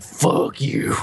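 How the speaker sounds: 低く息だけで吐き捨てる; hissed mock rage. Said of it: "this rage comes up".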